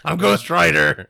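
loud, high mock-heroic yell; Nicolas Cage. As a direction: bellow it as an absurd announcement. Shouted Cage impression, absurd line.

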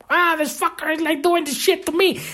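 high, mocking voice of an annoyed grump; mock angry grumbler. Imitating an angry person complaining about others. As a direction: sneer it like a petty complainer. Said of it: "I'm not looking at".

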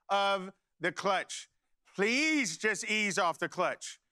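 drawn-out, patient plea; slow pleading. Retold or imitated. Father begs son to ease off clutch, slow pleading. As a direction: stretch "Please" out, imitating an exasperated teacher.

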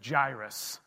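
Jairus said as "EYE-rus", falling at the end; final Jairus with a falling close; church's Jairus. Shown as a demonstration. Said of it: "we like to say Jairus".

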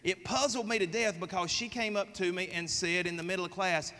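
ordinary talk, nothing marked

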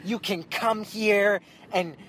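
put-on high, loud, slow drunken tirade; wasted and enraged, near tears; drunk angry rant. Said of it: "they get pissed".

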